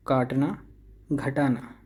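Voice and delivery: normal talking voice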